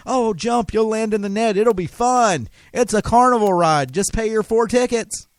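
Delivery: high, sing-song sales pitch luring you to jump; mock cajoling carnival barker. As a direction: sweet-talk them off the cliff like a fairground hawker. Voicing the system's cajoling lure.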